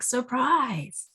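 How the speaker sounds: a surprise with no one left to scare; tired jack-in-the-box. Retold or imitated. Jack-in-the-box pops out again to a blank screen, half-hearted. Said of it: "he's like".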